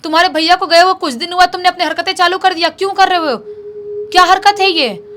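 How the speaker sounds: acted indignant yelling, high and forceful; loud scolding shout. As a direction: shout up at him, demanding answers. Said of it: "ज़ोर-ज़ोर से आवाज़ लगाने लगती है".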